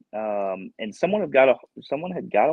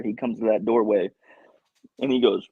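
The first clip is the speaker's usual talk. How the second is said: usual speaking voice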